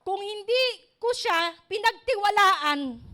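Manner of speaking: a slow, deliberate preacher's emphasis; slow emphatic line. Real, not acted. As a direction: lean hard on each word to drive the point home. Preacher stresses a key point.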